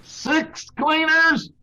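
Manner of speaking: high, slow cry of disbelief; disbelieving. Acted. Salesman learning of the sixth cleaner, high and slow disbelief. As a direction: draw out the shock. Murdoch stunned again.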